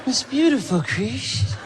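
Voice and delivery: high, gentle Michael impression with a laugh; [name]. Michael renaming Chris as Christmas, high and sweet. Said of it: "I go".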